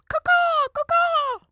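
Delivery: very high screeching crow caw; shrill screeching bird woman. Pretend. Mocking hosts as screeching birds.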